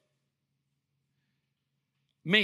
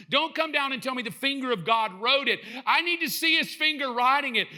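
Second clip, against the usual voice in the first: indignant demand; 4 semitones higher than usual. Acted. Skeptic demanding to watch God write, indignant demand. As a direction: build into a worked-up demand for proof.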